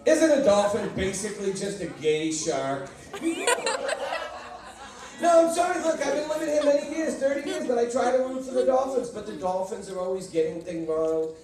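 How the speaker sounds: normal talking voice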